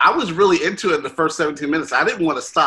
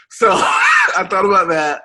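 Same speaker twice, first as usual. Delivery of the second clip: laughing; 5 semitones higher, 6 dB louder than usual. Real, not acted. Laughing at Bill Nye memory.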